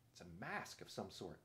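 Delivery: low, soft thinking aloud; hushed inner voice; private thought. A narrator's reading. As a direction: drop down softly, as if thinking to yourself. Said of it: "thought Caspian".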